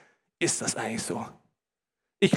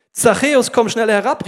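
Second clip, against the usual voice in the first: loud, raised call from the reader; loud, higher-pitched summons; 4 semitones higher, 9 dB louder than usual. A narrator's reading. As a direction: call someone down from a height. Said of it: "rief Jesus".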